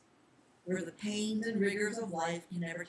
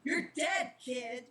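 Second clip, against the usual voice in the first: put-on a cold threat to the kid, spat; 4 semitones higher, much slower than usual. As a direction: spit the words at the boy. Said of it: "he spat".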